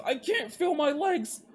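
high, strained agonized wailing; desperate high-pitched yelling; pained panic. A narrator's reading. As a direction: cry out in rising panic through the pain. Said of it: "he mumbled through clenched teeth".

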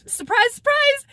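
high, loud mock reveal; hammed-up sarcastic surprise. Real, not acted. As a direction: announce the obvious like a big shock. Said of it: "sarcastic reveal".

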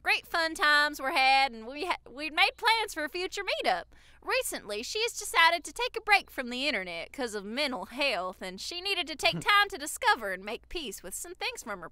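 Southern accent. Shown as a demonstration. Reading a fan's Tinder backstory, hammed-up Southern accent. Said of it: "read it in a Southern accent".

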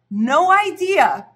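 "no idea" thrown up high and loud, slow; emphatic no idea. Real, not acted. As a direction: hit "no idea" hard with a high, stretched peak. Emphatic repetition in testimony.

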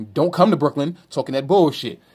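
usual speaking voice

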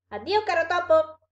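high, loud farewell; exclaimed in one breath. A narrator's reading. Fox bolts from the monster, exclaiming in one breath. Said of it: "d'un fiato esclamò".

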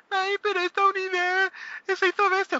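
high, mocking whine; whiny forum crier. Forum player whining that his unit got too strong. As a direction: squeal it like a crybaby player. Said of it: "estén llorando la gente".

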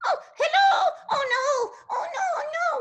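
frightened puppet wailing; scared and upset. Acted. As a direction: cry out in sudden fright as the friend vanishes. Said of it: "sound like you got, you got kind of, uh, scared and upset".

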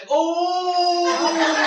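a long, slow, strained "Oh" pitched high and loud; pained drawn-out oh. Acted. Budgie straining to lay a square egg, a long pained oh. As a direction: groan it out like a bird squeezing out a huge egg.

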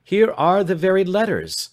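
loud, high alarmed cry; sudden loud high cry; cried out. A narrator's reading. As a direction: burst out in shock. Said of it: "he cried".

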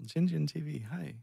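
normal talking voice

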